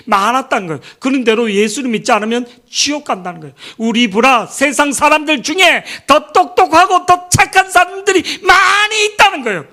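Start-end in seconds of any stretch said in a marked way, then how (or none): 3.8-9.6 impassioned preaching. Real, not acted. Preacher tells congregation worldly people can be better, impassioned and loud. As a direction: preach it at rising force, voice climbing high. Preacher driving the point home.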